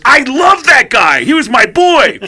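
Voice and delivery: high, loud, drawn-out mock wail; anguished protest. Drummer betrayed by his tattooist, high anguished shouting. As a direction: cry it out like a betrayed friend.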